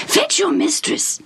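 high, urgent hushed order; hope bursting but held in; mock excited whisper. Father urges the servant, in a hushed excited voice. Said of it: "in an excited whisper".

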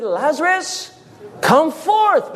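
high-pitched, commanding summons; calling out. Acted. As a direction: summon the dead from the grave. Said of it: "called into the place of the dead".

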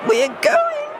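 very high, gleeful squeal. Acted. Evie squeals to her friends, thrilled high squeal. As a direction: jump up and down and squeal it. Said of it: "she squealed".

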